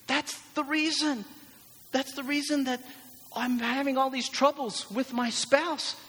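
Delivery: high, far-fetched revelation voice; exasperated spouse. Acted. Churchgoer blaming the spouse for all troubles, hammed-up exclaiming. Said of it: "you're thinking".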